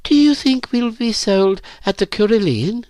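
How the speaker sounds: put-on high-pitched, wondering girl's voice; anxious girl. A narrator's reading. Virginia asks the narrator about being sold, anxious. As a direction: ask it high, like an uncertain girl.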